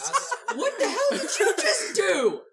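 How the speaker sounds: high, loud, drawn-out incredulous outburst; scandalized, amused exclamation; real laughing disbelief. Real, not acted. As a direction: call him out, shocked and laughing. Shocked reaction to the accent.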